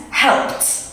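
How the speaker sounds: "helped" as one syllable ending in a crisp t. Shown as a demonstration. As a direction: show the voiceless t closing "helped". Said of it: "and then in the past tense".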